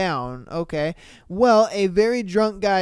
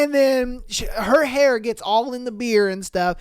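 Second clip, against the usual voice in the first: ordinary talk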